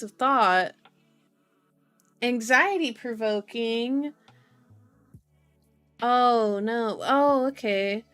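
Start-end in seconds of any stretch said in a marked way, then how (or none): none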